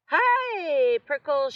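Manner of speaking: high, loud teasing shout. A narrator's reading. Jackrabbit dashing past teasing Hank, shouted. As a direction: shout the nickname gleefully. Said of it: "she shouted".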